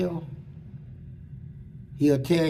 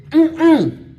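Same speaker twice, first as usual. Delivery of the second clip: high, loud hummed refusal; mouth-full "mm-mm"; 9 semitones higher, 7 dB louder than usual. Retold or imitated. Prisoner refuses the guards with his mouth full, humming. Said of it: "with that man thing still in his mouth".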